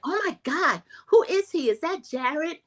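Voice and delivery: high squealy disbelief, pitched well up; mock shock. [name] reacting to the Jared unicorn, high mock shock.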